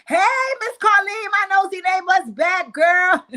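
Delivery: high, loud, slow sing-song call; hollering to the neighbor about the nosy neighbor; loud mock call to Miss Carlene. Reenacting Miss Carlene calling out.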